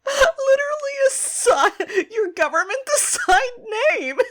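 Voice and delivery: high, laughing and squeaking; laughing disbelief. Real, not acted. Cohost laughing at the government-assigned name, high laugh. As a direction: crack up mid-word.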